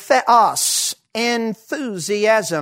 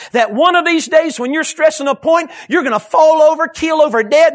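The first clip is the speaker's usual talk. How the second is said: higher, louder acted warning; urgent warning; 6 semitones higher, 5 dB louder than usual. Doctor friend warning the preacher about his health, urgent. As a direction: build to an alarmed warning, punching the words.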